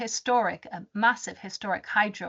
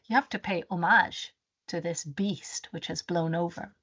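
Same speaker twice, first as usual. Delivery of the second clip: ordinary delivery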